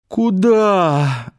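high word swallowed by a yawn; sleepy, stretched-out yawn; desperate yawning. A narrator's reading. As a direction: yawn right through the word. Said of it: "отчаянно зевая".

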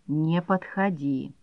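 quiet, slow, full of hatred; hoarse hateful hiss. A narrator's reading. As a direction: rasp it out with hatred. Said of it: "просипела она с такой ненавистью".

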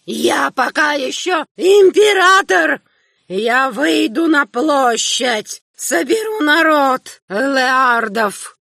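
put-on high, slow furious old man's shout; an old emperor defying his son; raging old emperor. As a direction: threaten like a mad old king. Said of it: "закричал старый император".

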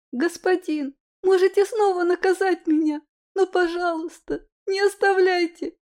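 put-on high pleading child's voice; pleading little girl. A narrator's reading. Girl begs not to be left.

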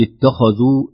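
اتخذوا with a thick, heavy ت; wrong, heavy ت. Shown as a demonstration. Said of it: "نفخم التاء التاء".

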